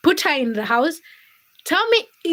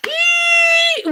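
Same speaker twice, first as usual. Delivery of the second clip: high, loud excited squeal; welcome-back shriek; 4 dB louder than usual. Real, not acted. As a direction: squeal with delight to open the show. Opening squeal to greet viewers.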